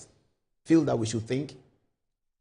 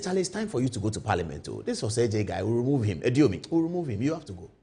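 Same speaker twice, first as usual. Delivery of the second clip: lowered boss voice; 8 semitones lower, 4 dB quieter than usual. Pretend. Minister voiced in story.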